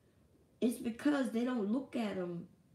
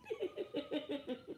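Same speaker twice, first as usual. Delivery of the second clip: soft high laugh; 8 semitones higher, 9 dB quieter than usual. Real, not acted. Laughing at himself.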